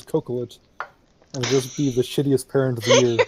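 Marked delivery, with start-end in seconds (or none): 0.0-0.6 cocolet with a hard K at the start; joke mispronunciation. Shown as a demonstration. Joking about misteaching a future child, cocolet with hard K. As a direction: say cocolet as KOH-kuh-let, like cocoa. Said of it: "the proper s-, uh, pronunciation is cocolet".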